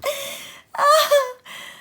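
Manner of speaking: long high cackling laugh of delight; high gleeful laugh. A narrator's reading. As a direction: burst into joyful laughter.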